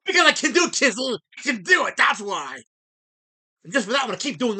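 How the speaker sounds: loud, high defiant comeback; defiant retort. Real, not acted. Streamer answering a chatter who mocked his music, loud and defiant. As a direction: throw it back in his face. Snapping back at a chat request.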